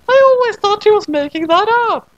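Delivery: put-on a squeaky girl musing; girl remarking as the saucer flies off; high Madoka voice.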